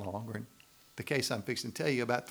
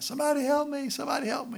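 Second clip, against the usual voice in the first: high-pitched, louder cry for rescue; hammed-up scream from a man in trouble; mock scream for help; 6 dB louder, faster than usual. Preacher acts the man dangling in the well, screaming for help. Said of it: "and he's screaming out".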